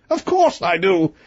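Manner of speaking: high-voiced storybook king; king's voice. A narrator's reading. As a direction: pitch the king up, grand and grudging. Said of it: "replied the king".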